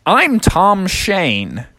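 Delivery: high, very drawn-out introduction; Tom Shane. Acted. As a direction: introduce yourself slowly as a quaint old man. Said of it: "the old man said".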